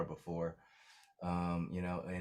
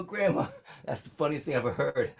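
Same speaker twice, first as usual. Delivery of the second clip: higher and louder with glee; retold delight, raised and bright; delighted laughing exclaim; 7 semitones higher, 6 dB louder than usual. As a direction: tell grandma it's the best thing you ever heard. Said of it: "I go".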